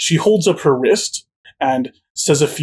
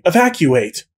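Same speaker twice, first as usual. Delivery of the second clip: usual speaking voice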